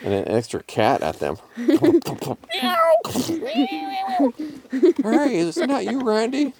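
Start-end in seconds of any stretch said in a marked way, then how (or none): 1.6-5.0 very high, long cat yowl; drawn-out high meowing wail. A narrator's reading. Thrown cat flies and lands. 5.0-6.5 high, questioning cat voice; pitched-up surprised recognition; cat character. A narrator's reading. Cat greets the thrown cat.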